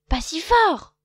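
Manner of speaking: loud, high vexed hiss-free scold. A narrator's reading. Loup telling Lapin to hush, loud and vexed. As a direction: snap at him, annoyed. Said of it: "dit Loup vexé".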